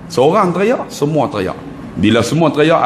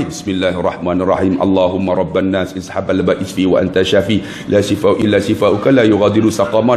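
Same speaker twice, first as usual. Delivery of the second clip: low, even Arabic recitation; reciting a prayer; 8 semitones lower than usual. Retold or imitated. Ustaz blessing zam-zam water for an elder, low recited prayer. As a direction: recite the healing dua in a low monotone. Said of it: "Dah baca doa apa semua".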